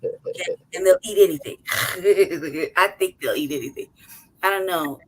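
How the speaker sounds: usual speaking voice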